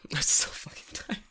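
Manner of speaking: laughing, breathy whisper; jokey whisper. Pretend. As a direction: whisper the running joke through a laugh. Echoes co-host's whispered running joke.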